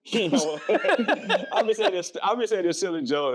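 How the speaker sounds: high-pitched, talking through real laughter; helpless laugh running into the words. Real, not acted. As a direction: crack up while trying to explain. Cracking up at host's mock speech.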